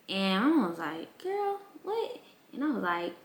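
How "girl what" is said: disbelieving. Retold or imitated. Mom's disbelief.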